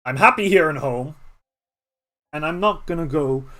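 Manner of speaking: loud, gruff refusal; grumpy old badger. Badger Nullig refusing Kieran, loud and gruff. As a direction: grumble it out like a stubborn old badger. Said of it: "Nullig kinda grunts and says".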